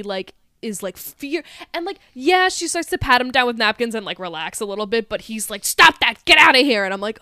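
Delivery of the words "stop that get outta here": loud retold telling-off; angry order. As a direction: snap it out like an annoyed customer. Retold angry man snapping at waitress.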